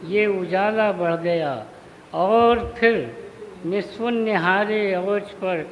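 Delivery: measured mushaira delivery, phrase by phrase; Urdu poetry recitation. Acted. Poet honouring Turfa before the gathering, measured recitation. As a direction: declaim each half-line and let it hang.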